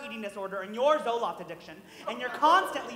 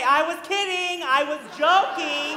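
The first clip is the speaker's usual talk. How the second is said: louder, half-shouted backpedal, hammed up; mock panicked protest; 3 semitones higher, 9 dB louder, slower than usual. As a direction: blurt the take-back like a cornered groom. Mock panicked take-back at altar.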